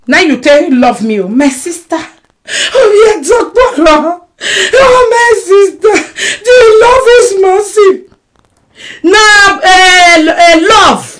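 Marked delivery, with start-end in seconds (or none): 2.0-11.2 mock crying. Mock crying about love.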